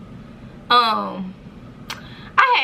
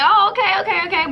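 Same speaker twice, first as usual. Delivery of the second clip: excited okay; 8 semitones higher, faster than usual. Retold or imitated. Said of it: "It was like".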